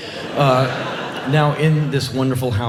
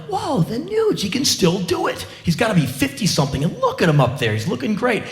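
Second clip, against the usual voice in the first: mildly awestruck, pitched up on the first words; amazed fan; 7 semitones higher than usual. Retold or imitated. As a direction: marvel at an old rock hero. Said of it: "I'm like".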